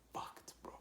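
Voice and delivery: breathless whisper. Real, not acted. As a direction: drop to a whisper about being wasted. Whispered aside.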